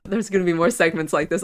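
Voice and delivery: ordinary talk, nothing marked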